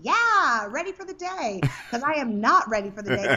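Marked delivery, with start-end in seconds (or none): 0.0-1.6 higher, bright-eyed, eager; chirpy morning person. Retold or imitated. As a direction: perk up like an eager riser. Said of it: "the alarm goes off and I'm like".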